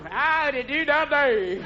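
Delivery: put-on slow, dragged-out drunk hillbilly; drunk sing-song. Drunk on Jack rolling over the abyss, slow and sing-song. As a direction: stretch it out like you're sloshed. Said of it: "You roll right over that abyss going".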